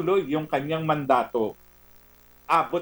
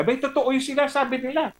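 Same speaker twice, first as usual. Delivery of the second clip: high, teasing jab with a grin; mocking jeer; 10 semitones higher than usual. Real, not acted. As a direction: rub it in, pitched way up. Mocking the president's complaint. (mapang-asar na panunukso)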